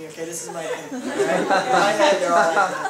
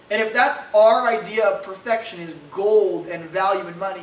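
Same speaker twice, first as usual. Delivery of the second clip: usual speaking voice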